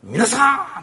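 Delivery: Jesus calling loudly in the temple court. Retold or imitated. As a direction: call out to the temple crowd. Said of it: "神殿の境内で大声で言われた".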